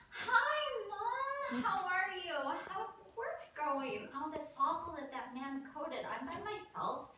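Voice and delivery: high, over-eager sing-out greeting; too cheerful. Acted. Teen answering mother's check-in call, far too cheerfully. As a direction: lay on fake cheer, pitched well up. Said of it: "too quickly and too cheerfully I answered".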